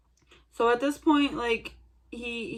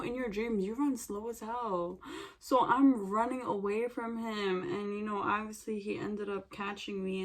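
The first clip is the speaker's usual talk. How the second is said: usual speaking voice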